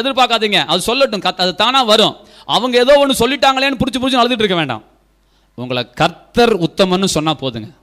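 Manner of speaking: loud, high-pitched exhortation; forceful preaching. Real, not acted. Preacher urging the congregation to ignore others' praise, forceful preaching. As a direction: drive the counsel home at full voice.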